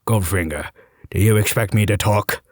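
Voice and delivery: low Scottish-tinged Bond voice; Sean Connery impression. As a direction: do your best Connery as Bond. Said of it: "said in my best Connery".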